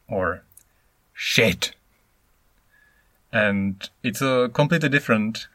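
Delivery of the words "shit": "shit" said louder with a sharp falling tone; falling punchy shit. Shown as a demonstration. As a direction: snap "shit" down at the end. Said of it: "Let me illustrate just quickly".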